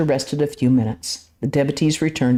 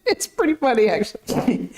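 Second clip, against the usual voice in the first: lightly laughing, higher; a hint of laughter in an aside; amused aside; 7 semitones higher than usual. Real, not acted. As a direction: still chuckling at the passage. Reader's amused aside after laughing.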